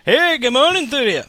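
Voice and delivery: put-on high, loud, botched English accent; terrible English accent. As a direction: attempt an English caller and miss badly. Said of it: "that was not an English accent".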